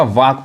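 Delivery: वाक with silent L; correct pronunciation. Shown as a demonstration. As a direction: go from long vowel straight to K in वाक. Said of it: "यानी एल साइलेंट है". (वाक, एल को खामोश रखकर)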